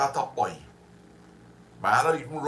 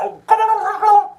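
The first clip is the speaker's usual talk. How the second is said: much higher, louder excited calling; excited shout; 11 dB louder, faster than usual. Real, not acted. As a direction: call out in delight.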